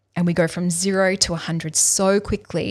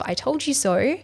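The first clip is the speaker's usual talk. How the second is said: condescending; 6 semitones higher than usual. Retold or imitated. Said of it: "in a condescending tone".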